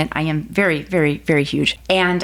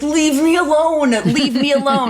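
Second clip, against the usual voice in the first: high, louder, whiny brush-off; irritated, high-pitched retort; exasperated protest; 8 semitones higher, 3 dB louder than usual. Retold or imitated. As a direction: plead to be left in peace, pitched way up. Pestered wife snaps at nudging husband.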